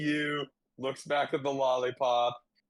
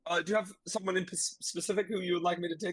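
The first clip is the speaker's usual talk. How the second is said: eager attendant voice; 6 semitones higher, much faster than usual. Acted.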